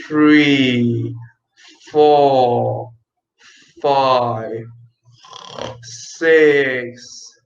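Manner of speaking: low, drawn-out counting; slow counting drill for the class; very slowly. Shown as a demonstration. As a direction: count each number slowly and deeply. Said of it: "Say one to ten very slowly".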